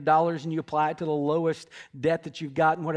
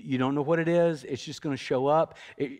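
normal talking voice

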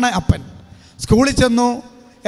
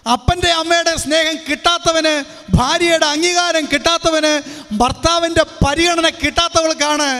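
raised-pitch preacher's proclamation; preaching; 6 semitones higher than usual. Acted. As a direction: preach it to the congregation. Preacher's rising list. (പ്രസംഗ ശൈലി)

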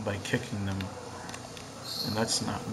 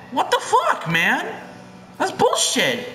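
outraged; 14 semitones higher, slower than usual. Real, not acted. Update punishes his attack, outraged high yell. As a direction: yell at the unfair game.

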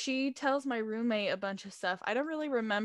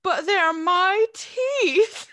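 slow, loud, higher whine; whiny protest; 7 semitones higher, 10 dB louder, much slower than usual. Retold or imitated. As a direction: drag out a drugged protest. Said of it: "I got pissed".

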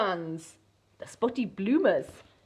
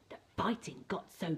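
whisper; 3 semitones lower, 10 dB quieter than usual. A narrator's reading. Whispered narration line.

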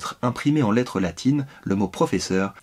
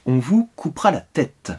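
a cheerful, higher stranger voice announcing doom; gleeful announcement; 4 semitones higher than usual. A narrator's reading. The stranger gaily tells Berlioz his fate, cheerful announcement. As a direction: announce it brightly, relishing the shock. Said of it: "il annonça gaiement".

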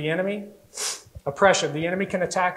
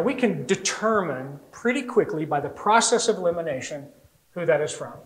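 ordinary talk

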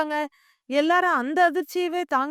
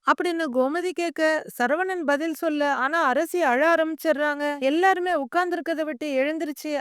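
usual speaking voice

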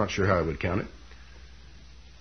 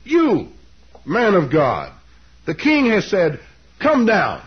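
loud, high mock order in short bursts; hammed-up, sarcastic royal order; sarcastic command; 8 semitones higher, 5 dB louder, slower than usual. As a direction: bark it out as a mocking summons. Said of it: "The sarcasm is very implicit".

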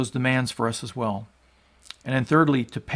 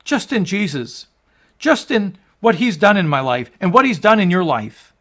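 louder and higher, building to a roaring proclamation; full-force preaching; 5 semitones higher, 6 dB louder than usual. Acted. Preacher to his congregation on the paid debt, building full-force preaching. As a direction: preach it out, swelling with every line.

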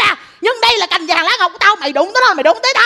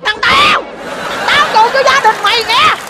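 shouted cursing. Acted. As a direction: start the tirade with a shout. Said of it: "Má mày chửi".

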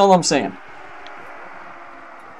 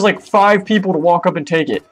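ordinary delivery

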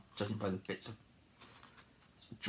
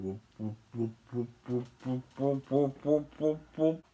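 whoops climbing in pitch and volume; whooping time-machine noise; 3 semitones higher, slower than usual. Acted. Imitating a machine travelling through time, rising whoops.

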